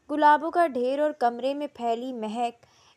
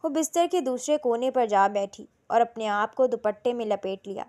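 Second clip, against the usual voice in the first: ordinary delivery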